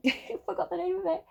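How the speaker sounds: high, giggly confession; laughing admission. Real, not acted. Reader forgot the song's name, laughing admission. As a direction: admit it in a high voice through a laugh.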